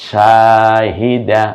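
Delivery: Shahida stretched syllable by syllable, each vowel held long; slow drawn-out reading. Shown as a demonstration. Teacher to learners, showing the joined word, very slow. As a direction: draw out Shahida slowly and clearly. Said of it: "এটা পড়ার চেষ্টা করুন".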